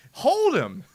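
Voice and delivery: furious fan shouting at the screen; angry shout. Real, not acted. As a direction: yell the order, fed up and half laughing. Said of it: "I know I'm, I'm getting angry".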